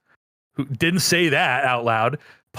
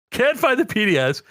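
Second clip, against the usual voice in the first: ordinary talk, nothing marked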